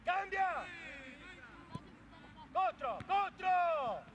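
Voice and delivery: loud sideline shouts; shouting. Real, not acted. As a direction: yell orders across the court. Coach yelling at players.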